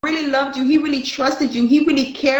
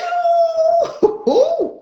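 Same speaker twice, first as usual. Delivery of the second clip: burst of laughter. Real, not acted. Laughing at the ghost's anger, high cackle. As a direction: crack up at her own story. Laughs after "he mad at you.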